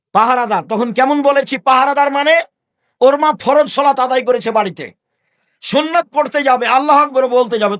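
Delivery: preacher thundering at his congregation, loud and high; preaching at full force. Real, not acted. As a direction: drive the point home to the whole crowd.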